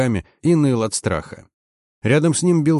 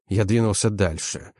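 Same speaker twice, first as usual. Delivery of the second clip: ordinary delivery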